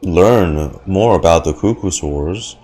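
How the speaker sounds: ordinary talk, nothing marked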